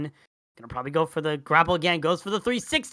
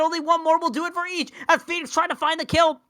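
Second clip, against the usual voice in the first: pitched way up, urgent call; excited commentary; 10 semitones higher, 5 dB louder than usual. Real, not acted. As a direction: build to the final shot. Match point, decisive shot.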